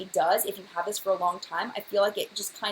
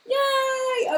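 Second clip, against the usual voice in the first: high squeal of delight; cheer, pitched way up; excited yay; 5 dB louder than usual. Real, not acted. Cheering a viewer who can keep up, high yay.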